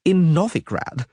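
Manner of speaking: high, whining bard voice; frightened poet whining to his friends. A narrator's reading. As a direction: complain it, nervously. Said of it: "Dandelion whined".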